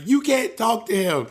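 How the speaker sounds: retold stern ban; forbidding mom. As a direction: imitate a mother laying down the law. Mom forbids talking to brother.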